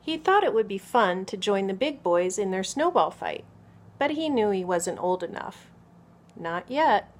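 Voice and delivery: put-on expressive picture-book narration for small children; story read-aloud. A narrator's reading. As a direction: read clearly and with lively emphasis to children.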